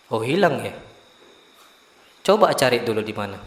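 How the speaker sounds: low and soft, taking the news evenly; retold teacher's unruffled answer, voice dropped; calm, unfazed reply. Teacher told by student the goat is gone, calm and unfazed.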